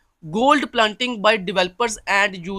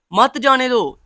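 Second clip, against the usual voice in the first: "मत जाने दो" as its own louder, higher command; मत जाने दो separately; 5 semitones higher, 6 dB louder, much slower than usual. Shown as a demonstration. Teacher giving the split reading, "मत जाने दो" on its own. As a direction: set "मत जाने दो" apart as a full order. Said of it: "को अलग पढ़ूंगा".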